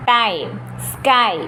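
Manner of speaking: ടൈം and സ്കൈ said very slowly, stretching the ai glide; drawn-out teacher example words; slow demo words. Shown as a demonstration. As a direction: model the ai sound slowly and clearly. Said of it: "ആ ഒരു sound നമുക്ക് കേൾക്കാൻ പറ്റുന്നുണ്ട്".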